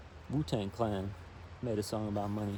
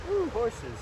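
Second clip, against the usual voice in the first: a high delighted ooh; delighted aside; 11 semitones higher, 5 dB louder than usual. Real, not acted. Reader pausing the book, delighted by horses. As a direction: brighten up at the thought of horses.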